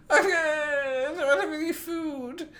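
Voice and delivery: very high, wailing cat voice; cat as a human. Shown as a demonstration. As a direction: whine it like a complaining cat. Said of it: "if he was a human".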